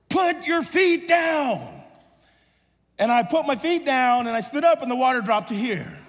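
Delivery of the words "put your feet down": high, slow lifeguard holler; calling out. Retold or imitated. Lifeguard instructing a struggling boy, drawn-out call. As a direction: stretch each word to carry across water.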